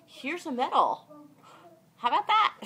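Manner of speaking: loud, high, playful flourish; joking triumphant praise; mock award presentation. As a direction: present the imaginary prize grandly, pitch climbing. Imagined manager handing her a medal.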